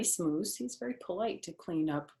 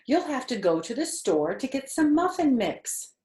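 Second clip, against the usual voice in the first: higher, louder storybook voice; reading to children; 6 semitones higher, 4 dB louder than usual. Acted. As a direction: read it brightly to a little listener. Reading picture book aloud.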